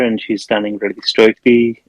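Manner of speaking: ordinary talk, nothing marked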